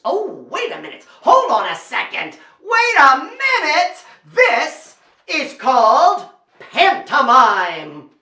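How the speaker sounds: loud, stretched-out showman's reveal; dramatic announcement. Acted. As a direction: halt everything, then announce it big and slow. Stagy build-up to announcing pantomime.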